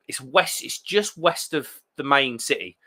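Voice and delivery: ordinary talk, nothing marked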